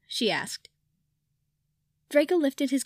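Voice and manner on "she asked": plain reading voice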